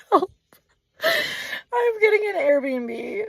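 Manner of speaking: high, slow wail with a laugh in it; tearful wail. Real, not acted. Camper giving up on camping, tearful laughing wail. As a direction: cry for help, half laughing. Laugh-crying meltdown about the campsite.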